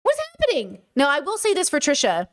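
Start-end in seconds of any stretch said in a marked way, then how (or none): 0.0-0.8 much higher, squeaky, exasperated disbelief. Retold or imitated. Retelling her reaction to her partner, high exasperated disbelief. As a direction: throw your voice way up in helpless disbelief. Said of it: "I was like".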